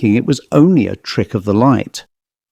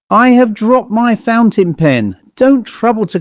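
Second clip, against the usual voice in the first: exclaiming; 9 semitones higher than usual. Acted. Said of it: "Gilbert exclaimed".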